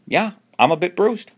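high, loud bruised reply; Alan voice. Acted. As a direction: sound sore but fine.

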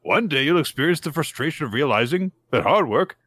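high, sing-song grandiose voice; lofty old neighbor. Retold or imitated. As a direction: deliver it like a pompous sage.

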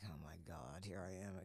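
low, hushed self-pity; retold dismay. Telling co-host about being back in a day job, low dismay. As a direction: drop right down, subdued and resigned.

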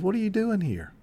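God gently asking the frightened prophet; spoken tenderly. Acted. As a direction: ask it tenderly, without blame. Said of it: "He spoke to him tenderly".

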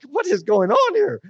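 bewildered exclaim. Retold or imitated. Bewildered by the angry caller.

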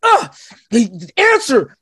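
manic revelation. Retold or imitated. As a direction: drag it out like a madman finding truth. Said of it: "I'm like".

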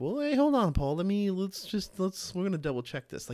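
high, loud call to stop and double check; Bereans pushing back. Retold or imitated. Bereans stopping Paul to check his words, high and loud. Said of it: "the Bereans were like".

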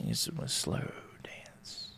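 slow hushed whisper; whispered aside. Acted. Host teasing about getting close, slow whisper. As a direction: whisper it like a secret.

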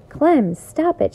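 a mom shouting the dog to stop; firm shout. A narrator's reading. Mother shouting at the dog, slow and firm. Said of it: "she shouted in a very firm voice".

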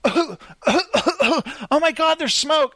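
high, slow, hammed-up fright; playful impression of kids freaking out; mock kid panic. Kids panicking at fake smoke.

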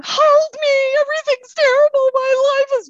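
high, loud, wailing self-pity; whiny crying drunk. Retold or imitated. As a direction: wail it like a weepy drunk. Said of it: "crying drunks".

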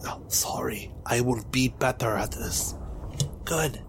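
soft hushed apology; whispering. Acted. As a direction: whisper it sheepishly.

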